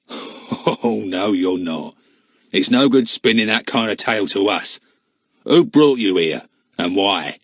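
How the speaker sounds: a man's scoffing voice; lightly voiced doubting man; gruff stranger. A narrator's reading. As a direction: brush off their story. Man disbelieving the children.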